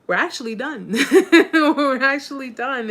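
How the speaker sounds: loud, laughing through the words; can't stop laughing while telling him; real laughter breaking up the line. Real, not acted. Laughing at rewinding viewer.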